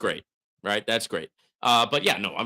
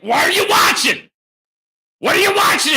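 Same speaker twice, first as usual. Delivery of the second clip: loud, high challenge; hollering; 9 dB louder than usual. Real, not acted. Streamer answering a viewer who dislikes it, hollering. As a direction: holler it straight back at the complainer. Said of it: "you keep hollering".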